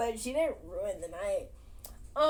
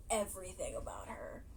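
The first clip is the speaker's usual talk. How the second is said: soft whisper; 4 semitones lower, 10 dB quieter than usual. Real, not acted. Telling how much Pocholo loves Judith, whispering. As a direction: breathe it out tenderly. Tender aside about Pocholo.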